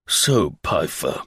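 slow, low stern official voice; bully him like a Prussian policeman. Acted. Slingsby mocking a Prussian police official, stern slow voice. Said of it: "imitation of a Prussian police official".

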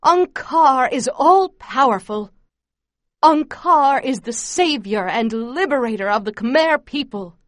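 loud, fervent propaganda proclamation; furious, adoring yell. A narrator's reading. Camp leader to squatting children, yelling with fury and adulation. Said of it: "In a voice full of fury and adulation".

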